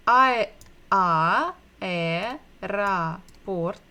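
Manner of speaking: "I, a, e, ra, port" broken into slow separate syllables. Shown as a demonstration. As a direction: sound out aeroport piece by piece, pausing between. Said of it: "aeroport begins with bukva A".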